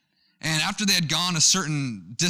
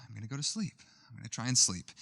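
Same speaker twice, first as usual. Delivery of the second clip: much softer, under the breath; quiet self-talk; 3 semitones lower, 9 dB quieter, faster than usual. Retold or imitated. Lying in the tent telling himself to sleep, softly. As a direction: murmur it to yourself in the dark.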